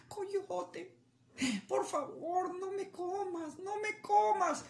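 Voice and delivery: high, begging bunny voice; pleading rabbit. Acted. Rabbit begging the coyote, high pleading voice. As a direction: plead for your life in a squeaky voice. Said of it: "le dijo al coyote".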